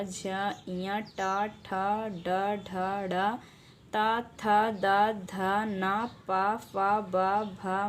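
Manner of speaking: slow, drawn-out letter-by-letter chanting; slow alphabet recitation. Shown as a demonstration. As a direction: recite each letter slowly for the class. Teacher recites the consonant list. (धीमे स्वर में वर्णमाला का पाठ)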